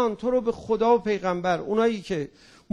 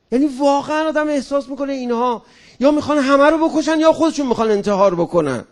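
loud, outraged rant; indignant outburst; 4 semitones higher, 7 dB louder than usual. Real, not acted. Speaker rails at the newspapers, loud and indignant. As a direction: throw it out, fed up and loud.

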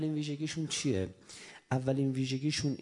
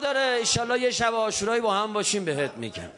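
loud, high, impassioned preaching; fervent preaching; 10 semitones higher, 9 dB louder than usual. Acted. Preacher urging the mourners toward Ashura, loud. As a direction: preach it out with full fervor.

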